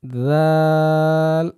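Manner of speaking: দাল drawn long and thin, not like জাল; thin light letter. Shown as a demonstration. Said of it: "একটু পাতলা করে বলার চেষ্টা করবেন". (দাল পাতলা করে টেনে)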